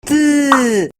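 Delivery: "T" as a short voiceless tongue tap with no vowel; only the tap of "T" behind the teeth; bare T sound. Shown as a demonstration. Teacher leading the class in the bare T sound. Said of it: "Nuevamente hagámoslo".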